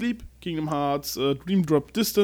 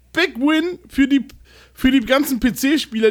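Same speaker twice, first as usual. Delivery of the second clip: excited; 7 semitones higher, 5 dB louder than usual. Real, not acted. Hyped game news announcement.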